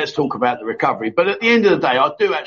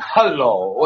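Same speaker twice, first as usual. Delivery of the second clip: lightly voiced coy hello, a bit lower; 4 semitones lower than usual. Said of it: "these lady boys are going".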